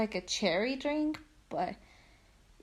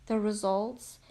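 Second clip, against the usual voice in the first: ordinary talk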